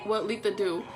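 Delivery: ordinary delivery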